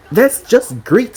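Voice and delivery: loud, high, drawn-out sarcasm; sarcastic. A narrator's reading. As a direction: draw it out with heavy sarcasm. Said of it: "The amount of sarcasm in her tone".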